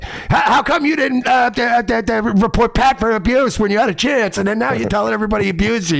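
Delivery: high, stammering mock accusation. Quoting someone's angry challenge.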